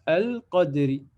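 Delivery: "al-Qadri" starting on a clear "a"; alif pronounced as hamza. Shown as a demonstration. Teacher showing the word-initial form, "al-Qadri" with its hamza sounded. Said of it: "ça se prononce tout simplement comme la hamza".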